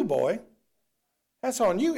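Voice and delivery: ordinary delivery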